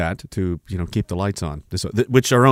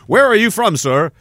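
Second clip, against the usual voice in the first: high, louder polite question; mock questioner; 11 semitones higher, 6 dB louder than usual. Official asking a traveler where he's from. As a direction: ask it brightly like an official. Voicing an asker in skit.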